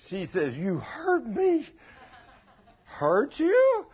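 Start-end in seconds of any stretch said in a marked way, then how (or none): 0.6-1.7 higher, slow whiny wife; imitating the wife's hurt voice; mock hurt wife. Mimics wife's complaint. 2.9-3.9 high, louder disbelief; can't believe it; husband amazed at the accusation; incredulous. Retold or imitated.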